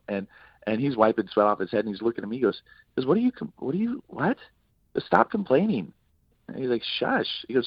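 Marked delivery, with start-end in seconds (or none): 3.1-5.9 high, sputtering imitation of disbelief; retold incredulous scolding; exasperated retort. Acted exasperated reply to a complaint. 7.0-7.4 high, quick mock shush; impatient high hush; retold shushing. Acted hushing of the complainer.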